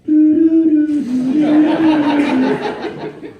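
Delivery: a high, loud nervous giggle burst; imitating brother's giggle. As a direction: mimic a kid's uncontrollable giggle. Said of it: "My brother does this".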